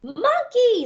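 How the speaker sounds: high shrieked cry of panic; scream. Acted. Terrified animal screaming at the giant monkey, high and loud. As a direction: shriek it in terror. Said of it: "screams the orange can".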